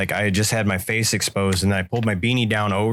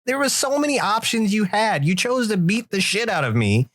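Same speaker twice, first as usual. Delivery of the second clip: rising, higher exasperated rant; indignant complaint; 8 semitones higher than usual. Retold or imitated. Scolding the buddy about the shovel, rising indignation. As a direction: build the outrage line by line. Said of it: "I was like".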